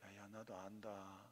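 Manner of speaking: low, very soft and slow reassurance; God answering. Acted. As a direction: voice God gently saying it, drawn out. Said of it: "그래놓고 있어 하나님께서". (낮고 느리게 달래는 하나님 목소리)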